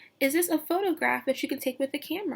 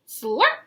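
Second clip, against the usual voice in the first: loud, high slurping sound word; 6 semitones higher, 7 dB louder than usual. Acted. Teacher reading the butterfly drinking nectar, a loud slurp. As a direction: make it sound like sucking a straw.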